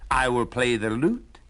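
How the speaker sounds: a jolly donkey selling his plan; hearty old donkey. Acted. Donkey invites the hound.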